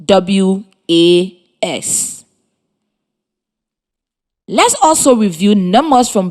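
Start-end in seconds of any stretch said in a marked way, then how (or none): 0.0-2.2 "W A S" letter by letter, slowly; spelling out. Shown as a demonstration. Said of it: "it is spelled".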